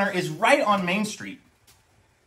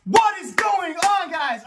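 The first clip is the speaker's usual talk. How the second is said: excited intro; 11 semitones higher, slower than usual. Real, not acted. Vlog intro greeting.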